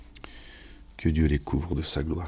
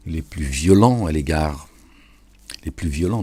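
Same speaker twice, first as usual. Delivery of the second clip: emphatic loud repetition; 7 dB louder than usual. Real, not acted. Repeating the verse emphatically.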